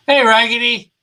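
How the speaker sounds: a character loudly greeting Raggedy; calling out. Acted. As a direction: call his name to get his attention.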